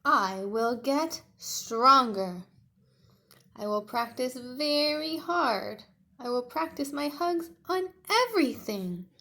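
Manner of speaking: loud, slow, resolute little dinosaur; determined Tiny. Acted. Tiny promising himself to practise, loud and determined. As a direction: vow to practise hard.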